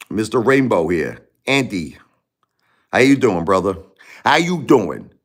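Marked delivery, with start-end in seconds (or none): 4.2-5.1 Brooklyn accent. Shown as a demonstration. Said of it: "that's how we say in Brooklyn".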